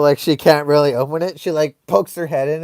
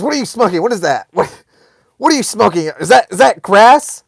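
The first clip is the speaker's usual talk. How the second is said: raised, high-pitched interrogation; mock angry demand, building louder; angry mom; 6 semitones higher, 7 dB louder than usual. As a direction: imitate an outraged mom firing questions. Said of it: "She was so angry".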